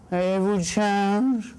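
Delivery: slow, drawn-out begging voice; panhandler. Acted. Beggar asking someone leaving the cash machine, drawled plea. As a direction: stretch it out like a street beggar.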